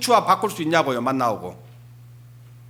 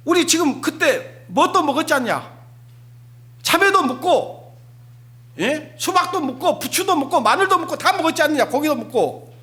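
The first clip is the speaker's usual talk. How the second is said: higher, whiny listing of foods; grumbling nostalgia; 7 semitones higher than usual. Acted. Israelites grumbling to Moses, higher whiny reminiscing. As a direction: moan about the food you miss.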